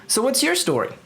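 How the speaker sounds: the druid asking the hero; curious elf's question; lightly voiced druid. Half-elf druid speaks.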